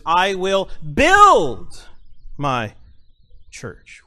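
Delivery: "I will build" loud and slow, "church" fading out; stress on BUILD. Shown as a demonstration. Pastor to congregation, stressing "build" slowly. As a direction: draw out and hit "build". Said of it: "Let's continue".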